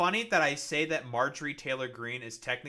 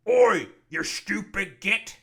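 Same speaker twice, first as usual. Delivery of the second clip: slow, loud, higher Warhammer orc accent; 7 semitones higher, 11 dB louder, slower than usual. Shown as a demonstration. Gamer does a Warhammer 40K orc insult in orc accent. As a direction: bellow it like a Cockney Warhammer orc. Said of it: "I like doing the orc accent from, like, forty K".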